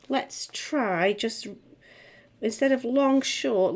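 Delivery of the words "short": ordinary talk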